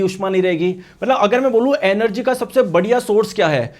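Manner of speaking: ordinary talk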